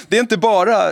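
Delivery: amused, laughing through the words. Real, not acted. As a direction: stumble over the phrase and break into laughter. Interviewer laughs at own stumble.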